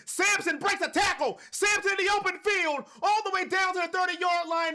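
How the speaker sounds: excited play-by-play shout. Real, not acted. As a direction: call the breakaway like a live announcer. Big run play-by-play.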